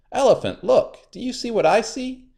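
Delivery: high trickster; high sly spider. A narrator's reading. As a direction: lure him to the rock. Anansi luring.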